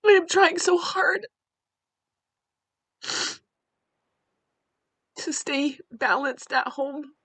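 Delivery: mother crying about holding the family together. Real, not acted. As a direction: force the words out through tears. Crying after reading note.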